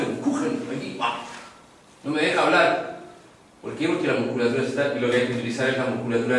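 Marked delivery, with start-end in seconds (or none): 0.0-1.2 softer, pushed and strangled; choked strain. Shown as a demonstration. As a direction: struggle to get the sound out. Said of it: "una apretuja".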